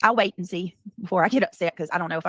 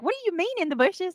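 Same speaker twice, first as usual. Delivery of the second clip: confused; 12 semitones higher than usual. Retold or imitated. Retold confused reaction.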